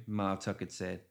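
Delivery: usual speaking voice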